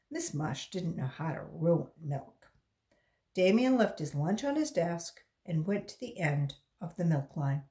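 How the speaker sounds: plain reading voice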